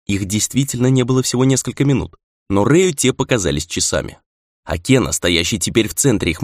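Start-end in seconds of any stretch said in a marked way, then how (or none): none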